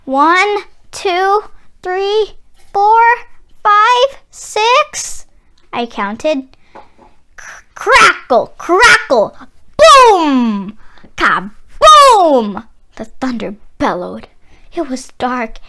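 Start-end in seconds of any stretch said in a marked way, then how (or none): 0.0-5.3 each number higher and more urgent; counting up higher. A narrator's reading. Child counting out the seconds to the thunder, voice climbing. 7.4-12.7 slow crackle building to a loud boom; be the thunder, crack then bellow. A narrator's reading. Narrator voicing the thunder as it bellows, loud booming.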